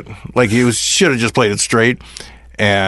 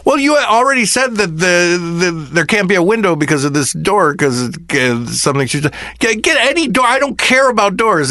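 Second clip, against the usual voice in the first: much higher, fed-up replay of the argument; exasperated retort; 8 semitones higher than usual. Retold or imitated. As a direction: throw up your hands at the question. Exasperated retort about doors.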